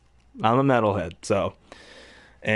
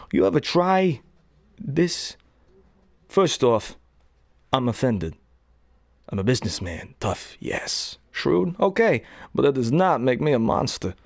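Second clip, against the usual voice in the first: understated Walken with odd pauses; real Christopher Walken. Shown as a demonstration. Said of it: "Real Christopher Walken".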